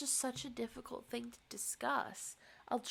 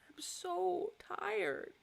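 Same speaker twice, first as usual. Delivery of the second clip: high, slow, tearful; crying; 7 semitones higher, much slower than usual. Real, not acted. Exhausted vlogger crying on camera, slow high sob. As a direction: weep the words slowly. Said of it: "This is a very interesting cry".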